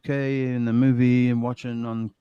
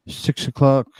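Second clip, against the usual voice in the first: talking through hiccups; 3 semitones higher than usual. Real, not acted. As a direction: hiccup between the words. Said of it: "You got the hiccups, buddy?".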